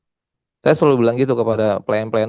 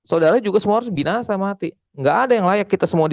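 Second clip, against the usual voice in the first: pitched-up fervent preaching; rising sermon fervour, raised pitch; 7 semitones higher than usual. Acted. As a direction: press the guilt home from the pulpit. Preaching at full force.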